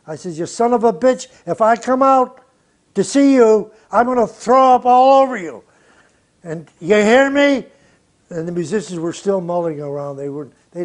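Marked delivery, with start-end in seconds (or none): 0.3-7.7 loud and higher, yelling him down; angry yell. Retold or imitated. Producer yelling at Miles through the studio, loud and angry. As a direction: shout the threat across the studio.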